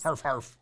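breathy huffing dog laugh. Retold or imitated. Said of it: "That's how dogs laugh".

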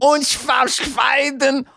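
high shouted fake German. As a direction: bark out fake German counting. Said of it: "Sorry, fake German".

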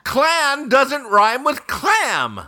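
slow, drawn-out stress on "clan" and "clam"; pointed correction. Real, not acted. Guesser corrects the clue-giver's rhyme, "clan" and "clam" stressed slowly.

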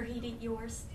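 ordinary delivery

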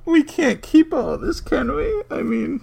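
very high, squealing through laughter; helpless laughter. Real, not acted. Hosts worried they can't keep this, helplessly laughing. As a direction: barely get the words out for laughing.

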